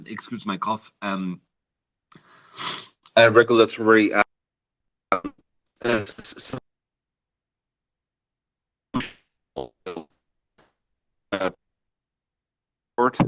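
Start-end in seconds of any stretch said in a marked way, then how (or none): 3.2-13.2 choppy, glitchy call audio breaking up; like a bad connection cutting out; robot voice. Real, not acted. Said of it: "I'm afraid you're robot voicing".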